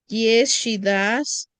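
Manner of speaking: slow, clear answer; deliberate teacher model. Shown as a demonstration. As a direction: say it slowly to copy. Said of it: "teacher models answer".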